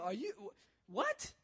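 retold incredulous reaction, pitch leaping at the end; stunned disbelief. Youth pastor asked to break the news to a son, stunned. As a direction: stammer into a high-pitched "what?".

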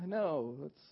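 normal talking voice

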